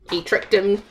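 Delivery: ordinary delivery, nothing marked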